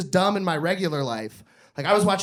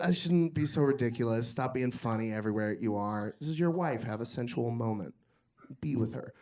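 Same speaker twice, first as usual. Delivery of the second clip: hushed, lower and much softer; quiet self-talk; 7 semitones lower, 8 dB quieter than usual. Retold or imitated. Comedian coaching himself to be sensual, hushed. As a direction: talk yourself down softly. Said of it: "And then I was like".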